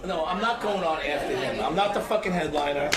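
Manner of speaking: normal talking voice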